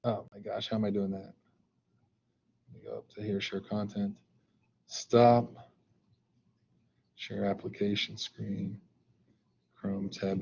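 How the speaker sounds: real low, soft self-talk; muttering to himself. Real, not acted. Teacher working out how to share content, muttering to himself. Talking himself through screen sharing.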